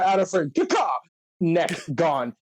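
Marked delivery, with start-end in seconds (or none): 0.6-0.9 high, sharp bird cry; eagle caw. Retold or imitated. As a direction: screech like an eagle. Imitating the eagle call.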